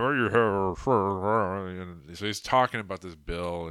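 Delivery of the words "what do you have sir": put-on feeble old senator voice, a bit louder; mock a doddering old politician asking; Mitch McConnell voice. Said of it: "he's in his Mitch voice".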